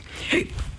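sudden hiccup into the mic; a real hiccup catching the breath. Real, not acted. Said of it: "I hiccuped right into the mic".

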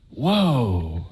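low drawn-out whoa; stunned low whoa. Retold or imitated. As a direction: let the shock sink in.

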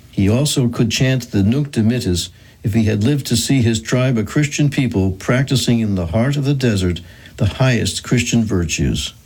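ordinary delivery

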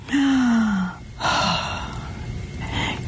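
long, wheezy gasp for air; mock breathless gasp. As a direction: gasp like a winded climber. Mimics a tired climber.

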